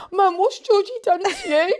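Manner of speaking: put-on high, alarmed child's voice; mother voicing her shocked daughter. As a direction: sound like a worried daughter. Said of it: "Isla come in and went".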